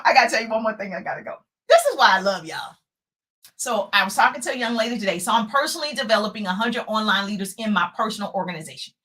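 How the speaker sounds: ordinary delivery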